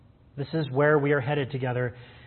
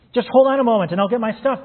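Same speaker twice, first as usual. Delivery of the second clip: higher and louder, bursting with eagerness; eager cry; 7 semitones higher, 7 dB louder than usual. A narrator's reading. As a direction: blurt it out like a keen friend who won't be left behind. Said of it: "cried Sam eagerly".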